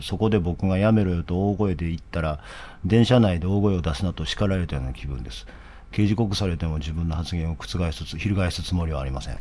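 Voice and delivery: quiet murmured read-through of a written statement; low soft drafting voice as if checking text; reading under breath. Real, not acted. Reading his press comment to himself, softly under breath.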